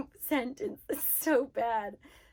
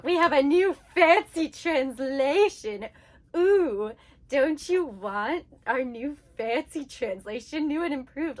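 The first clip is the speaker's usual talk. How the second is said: loud, hammed-up advertiser hype; mock sales pitch; 2 semitones higher, 6 dB louder than usual. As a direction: mockingly sell it as a big improvement. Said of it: "they try to sell it to you like it's some big improvement".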